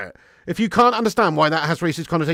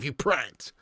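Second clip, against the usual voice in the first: ordinary talk, nothing marked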